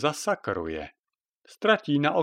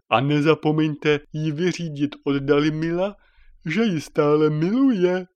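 message voice; 4 semitones higher, 5 dB louder than usual. Acted. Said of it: "message from Dalimil".

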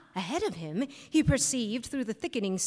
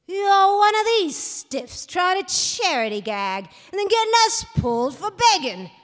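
higher, louder sneer of a street tough; 8 semitones higher, 7 dB louder than usual. A narrator's reading. Ugly fellow rounds on Harry on the street, sneering tough. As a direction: spit the accusation at him. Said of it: "he sneered".

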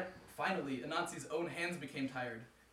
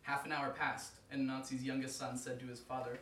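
usual speaking voice

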